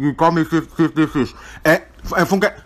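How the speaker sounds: normal talking voice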